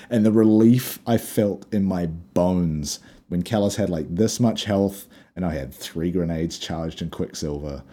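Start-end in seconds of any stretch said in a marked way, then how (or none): none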